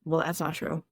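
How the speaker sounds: scoffing client. Retold or imitated. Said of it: "My clients will literally scoff at me".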